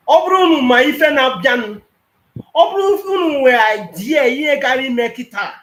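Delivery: loud, high furious rant; angry shout. Real, not acted. Angry caller shouting at the panelists, furious. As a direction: blast it out in anger.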